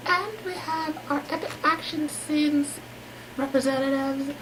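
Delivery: usual speaking voice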